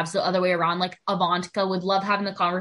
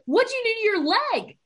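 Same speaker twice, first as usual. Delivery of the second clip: shocked question; 13 semitones higher, faster than usual. Retold or imitated. Retold shocked question to friend.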